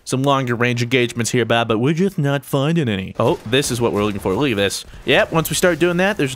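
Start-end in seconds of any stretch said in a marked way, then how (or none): none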